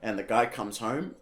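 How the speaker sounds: normal talking voice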